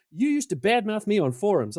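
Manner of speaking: a hint of playful indignation; accusing rival. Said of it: "He goes".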